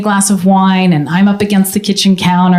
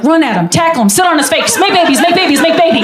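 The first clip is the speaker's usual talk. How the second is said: put-on teen self; 7 semitones higher than usual. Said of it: "The sixteen-year-old in my head was like".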